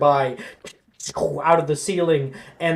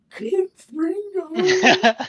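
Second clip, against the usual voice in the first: spell incantation; 11 semitones higher, much slower than usual. Acted. Casting a spell dramatically.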